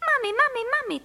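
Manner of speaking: put-on high little-bird child voice; high, piping calls; chirping. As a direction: chirp it like an excited small child. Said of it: "cvrlikal Filip".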